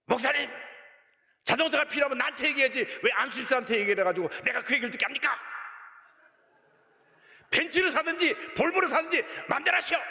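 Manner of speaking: high-pitched, hammering mock tirade; imitated angry yelling. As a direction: act out an elder yelling at the pastor. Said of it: "막 소리를 지르는데".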